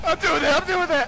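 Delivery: panicked scream. Real, not acted. Panicked reply.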